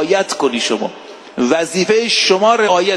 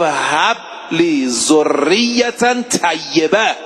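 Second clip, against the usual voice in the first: slow verse recitation; much slower than usual. Shown as a demonstration. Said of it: "گفت".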